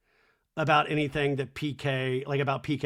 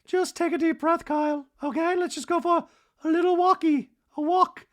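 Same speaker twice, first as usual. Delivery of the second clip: very high put-on coaxing voice; mock soothing; 14 semitones higher, 4 dB louder than usual. Dorit calming Kyle down, mock soothing. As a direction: imitate a housewife gently coaxing. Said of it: "And Dorit softly is like".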